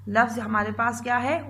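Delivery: ordinary talk, nothing marked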